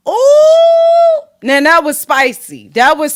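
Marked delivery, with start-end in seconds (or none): 0.1-1.2 long loud high sung-out ooh. Real, not acted. A long high ooh after reading the post aloud. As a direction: stretch out a delighted high ooh.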